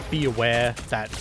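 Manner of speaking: normal talking voice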